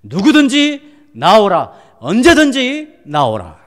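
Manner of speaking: high, loud, slow summons; calling out invitation. Retold or imitated. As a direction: call everyone forward with drawn-out force. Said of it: "예수께서 우리를 초대하시는 것입니다". (높고 크게, 천천히 불러 초대하듯)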